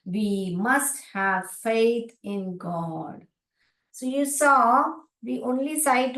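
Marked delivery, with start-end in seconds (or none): 0.1-3.3 slow reading aloud. Shown as a demonstration. Teacher reads the sentence to parents, very slowly. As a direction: read it out word by word, very slowly. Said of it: "sight word".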